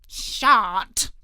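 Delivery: loud, gruff one-word reply; a surly cook refusing to talk; cook's voice. A narrator's reading. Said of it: "said the cook".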